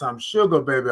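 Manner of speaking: ordinary talk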